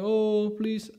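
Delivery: high, drawn-out whimpering plea; mock crying plea. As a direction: plead in a put-on tearful whine. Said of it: "And he was crying".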